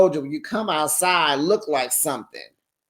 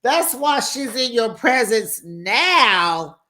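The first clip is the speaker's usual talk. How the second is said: incredulous repeat; 7 semitones higher, 3 dB louder, slower than usual. Retold or imitated. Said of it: "I said".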